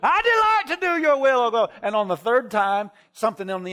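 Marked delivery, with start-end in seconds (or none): 0.0-1.7 high, loud, full-throated shout of praise; shouting a vow to God at the top of his lungs; hollering at the top of his voice. Retold or imitated. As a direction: belt the scripture out as loud as you can. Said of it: "So I hollered".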